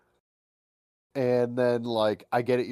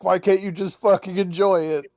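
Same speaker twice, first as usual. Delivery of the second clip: mock exasperated friend; 8 semitones higher, 6 dB louder than usual. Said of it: "He's just like".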